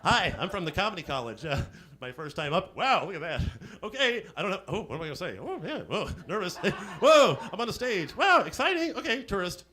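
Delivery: lightly voiced giddy newcomer; wide-eyed first-timer with laughs; nervous tourist comic. Comic mocks a first-timer on stage, giddy and nervous. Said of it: "cardboard cutout of you".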